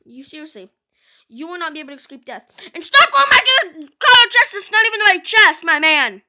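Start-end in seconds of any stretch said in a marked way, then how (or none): 2.7-6.2 high, loud telling-off; exasperated scolding yell. Pretend. Player telling Danny off about the chest, exasperated scolding yell. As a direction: shout it in play annoyance.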